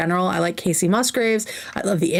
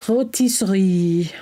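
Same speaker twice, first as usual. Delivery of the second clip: "Rotisserie" drawn out slowly, French style, no R; exaggerated French accent, savoring "Rotisserie"; slower French accent; slower than usual. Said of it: "say rotisserie with a French accent".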